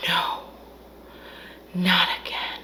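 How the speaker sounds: soft, breathy despair; mock hushed dismay. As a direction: let it fall to a near-whisper. Viewer's dismay at recapture.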